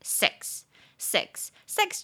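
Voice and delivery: "sex" repeated slowly and clearly; teacher repeating "sex" slowly; slow pronunciation. Shown as a demonstration. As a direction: model "sex" for learners. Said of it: "比较有礼貌的方式去说sex".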